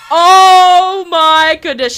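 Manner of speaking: loud, high, stretched-out exclamation; shocked cry. Pretend. Player reacting to Mitchell's death, shocked cry. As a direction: cry out slowly in mock horror.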